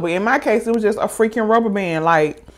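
ordinary talk

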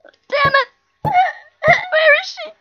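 high, loud frightened wail; real fright, crying out high and loud; real scared cry. Real, not acted. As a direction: panic at what might jump out. Scared in the dark basement.

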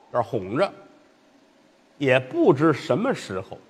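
usual speaking voice

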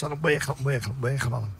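ordinary talk

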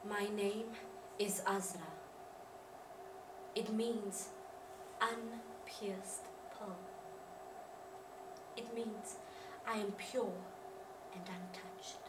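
low, hushed and halting; solemn introduction. Acted. Azra introducing herself and her name's meaning, solemn. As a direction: speak softly, with long pauses between phrases.

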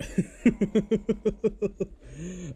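high laughing outburst; burst of laughter. Real, not acted. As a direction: crack up again. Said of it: "I just can't".

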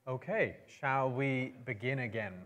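ordinary delivery

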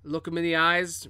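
ordinary talk

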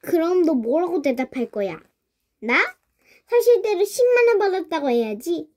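usual speaking voice

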